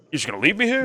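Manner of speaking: the crook can't believe they let him go; mock incredulous crook. Repeat of crook's incredulous line.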